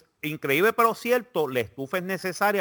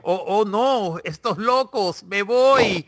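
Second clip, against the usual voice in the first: higher, louder panicked guest; mock a guest fleeing in fright; imitating scared guest; 7 semitones higher, 6 dB louder, much slower than usual. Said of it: "que otros invitados hacen".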